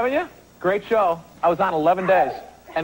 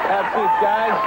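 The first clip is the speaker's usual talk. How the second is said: ordinary talk, nothing marked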